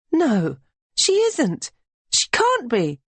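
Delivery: high, slow, pleading disbelief; desperate denial. A narrator's reading. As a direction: refuse to believe it.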